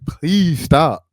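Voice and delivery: high, squeaky mock whine of the foundation; whiny, pleading voice for a mocked organisation; high mock plea to stop the records requests; mocking the Police Foundation. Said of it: "So they're like".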